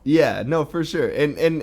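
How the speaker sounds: ordinary delivery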